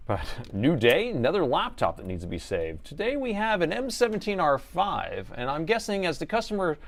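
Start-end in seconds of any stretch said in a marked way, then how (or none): 2.9-6.8 higher, hammed-up TV announcer intro. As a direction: sell the repair like a show host. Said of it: "you gotta say it like you're an announcer".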